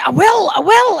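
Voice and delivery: high, loud mock shout; shrieked comic threat, each repeat punched; angry little man. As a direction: imitate an indignant little man insisting. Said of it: "this really angry little man".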